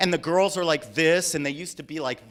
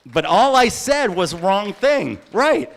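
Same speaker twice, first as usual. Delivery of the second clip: emphatic; 4 semitones higher, 9 dB louder than usual. Real, not acted. Emphatic point to audience.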